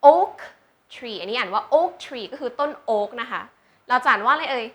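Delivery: ordinary delivery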